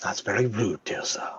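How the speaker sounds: low, hushed, restrained rebuke; quiet reproach. Acted. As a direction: answer the insult very softly, almost whispered. Randall's restrained reply to insult.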